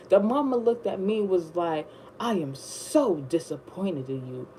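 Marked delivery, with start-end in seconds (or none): 2.2-4.5 disappointed mother. Retold or imitated. Voicing the boyfriend's mother.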